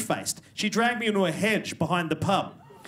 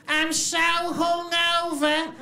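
much higher, very slow put-on grating voice; bridge troll voice; 12 semitones higher, much slower than usual. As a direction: drag out the words like a hungover troll. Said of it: "the voice of a fucking bridge troll".

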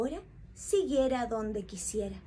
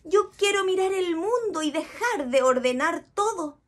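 higher whiny bored bee; 6 semitones higher, 3 dB louder than usual. Acted. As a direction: complain like a bored little bee. Cleaner bee complains, bored.

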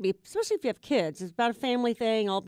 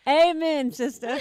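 loud, high, laughing agreement; hearty amen; 5 semitones higher, 6 dB louder, slower than usual. Real, not acted. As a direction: cheer it out like a church amen. Hearty agreement after joke about soft tummy.

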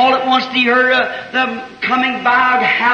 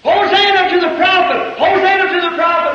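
crowd screaming; 7 semitones higher than usual. Retold or imitated. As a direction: cry it out like a cheering throng. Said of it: "thousand people screaming".

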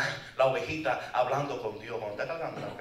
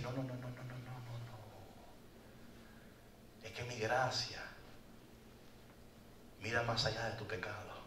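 soft, gentle, fading to a whisper; tender reassurance; 4 semitones lower, 11 dB quieter than usual. Pretend. God to the lost one, soft and tender. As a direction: comfort him quietly.

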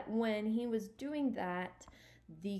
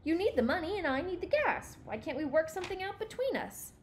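high, insistent challenge; demanding; 9 semitones higher, 5 dB louder than usual. Acted. Stuart to the station attendant, demanding. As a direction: press for an answer, pitched up. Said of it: "demanded Stuart".

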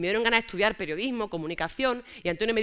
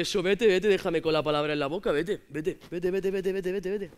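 1 boyfriend mocking; 3 semitones lower than usual. Boyfriend dismissing her.

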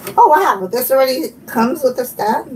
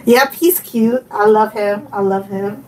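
normal talking voice

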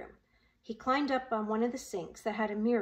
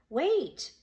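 higher-pitched call; urgent raised call; calling after someone; 5 semitones higher, 4 dB louder than usual. A narrator's reading. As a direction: call out to stop her leaving. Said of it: "Roy called after her".

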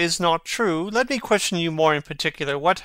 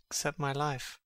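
much softer, almost under the breath; hushed murmur; 11 dB quieter than usual. Acted. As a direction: let it sink to a murmur. Mad Hamlet repeats the phrase.

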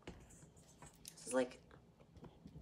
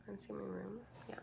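whispering; 13 dB quieter than usual. Real, not acted. Hushed question to viewers.

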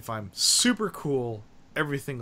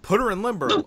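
mock demand; 7 semitones higher, 6 dB louder than usual. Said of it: "They're like".

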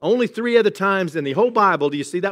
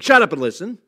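blunter command; 2 semitones higher, 6 dB louder than usual. Pretend. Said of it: "gentler word than saying".

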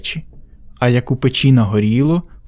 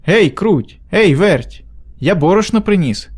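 higher-pitched storybook hailing; calling out; 5 semitones higher, slower than usual. A narrator's reading. Rooster home from the mill summons mice, calling out. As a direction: hail them lightly, pitch lifted. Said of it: "знов кличе мишенят".

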